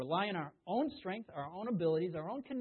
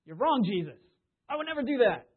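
loud protest, rising much higher; Peter; 6 semitones higher, 11 dB louder than usual. Acted. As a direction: contradict him loudly and indignantly. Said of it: "What's Peter really saying?".

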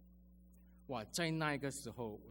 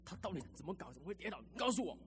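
retold angry demand; 6 semitones higher than usual. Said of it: "岳母这么凶哦".

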